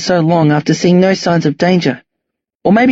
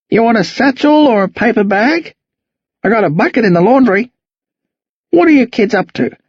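put-on old Aussie bloke; 5 semitones higher than usual. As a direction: grumble cheerfully like an old farmer. Jack rambles about pig trap.

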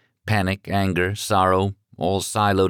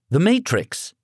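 put-on higher, sure teenage boy voice; confident teen answer; 11 semitones higher than usual. A narrator's reading. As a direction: blurt the title like it's obvious. Son names the film.

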